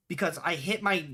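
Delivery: ordinary talk, nothing marked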